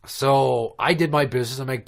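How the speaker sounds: usual speaking voice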